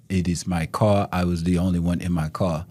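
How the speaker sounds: low-pitched imitation of a European accent. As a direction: insist as the European driver, voice dropped. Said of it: "That's your European accent?".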